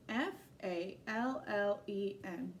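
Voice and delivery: letters spelled slowly; slow spelling. Shown as a demonstration. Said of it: "spelling letter by letter".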